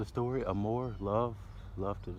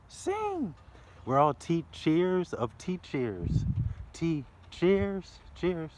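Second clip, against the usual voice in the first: playful high; 8 semitones higher, 3 dB louder, much slower than usual. Real, not acted.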